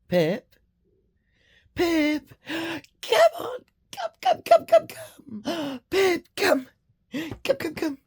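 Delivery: loud, very high calls to the dog; sing-song shouting for the dog to come; calling the dog. Real, not acted. Calling her dog Pip inside, loud high sing-song calls.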